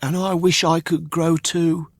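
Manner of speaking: put-on high, wistful boy voice; a puppet wishing aloud; high wistful Pinocchio; puppet boy's voice. Pinocchio speaks in the story.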